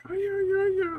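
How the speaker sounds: very high, loud mock squeal; piercingly high sing-song imitation; weird sing-songy voice. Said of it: "She does everything in this weird sing-songy voice".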